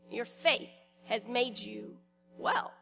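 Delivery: slow, with pauses after "faith" and before "well"; slow and deliberate. Shown as a demonstration. Preacher repeats Jesus' words to the congregation, slow and deliberate. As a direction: let "faith", "has made you" and "well" each stand apart. Same line repeated slowly, word groups parted.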